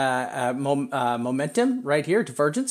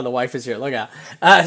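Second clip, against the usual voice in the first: ordinary delivery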